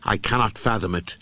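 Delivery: ordinary delivery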